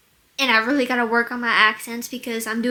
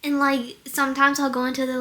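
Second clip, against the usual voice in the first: ordinary talk, nothing marked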